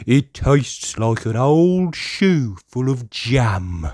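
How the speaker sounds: slow, disgusted pony voice; drawn-out yucky complaint; disgusted Pedro. Acted. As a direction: pull a face at the medicine. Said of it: "cries Pedro".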